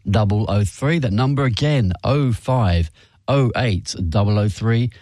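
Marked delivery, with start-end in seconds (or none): none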